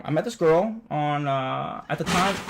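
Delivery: usual speaking voice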